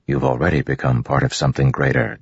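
usual speaking voice

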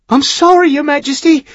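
high, loud, tearful apology; sobbing. A narrator's reading. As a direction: choke out the apology through sobs. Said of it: "the bleeding young midshipman sobbed".

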